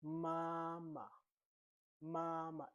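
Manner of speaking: MAMA with a long, level high first MA. Shown as a demonstration. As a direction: Mandarin first tone on MAMA, second syllable short. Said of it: "we are using the first tone".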